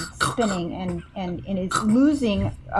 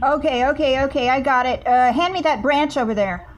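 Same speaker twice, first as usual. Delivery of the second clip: louder, urgent commands; 5 semitones higher, 6 dB louder than usual. Acted. Bear urgently ordering the others to fetch a branch. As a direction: bark orders while grabbing for it. Bear reacting to falling crane.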